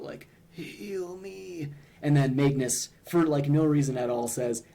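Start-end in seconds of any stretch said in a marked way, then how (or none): 0.5-1.7 a soft, higher mock groan of a wounded man; groaned plea. Retelling wounded Barry Blue Jeans begging for healing, a soft mock groan. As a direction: moan it weakly as if hurt, only lightly acted. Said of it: "groans out".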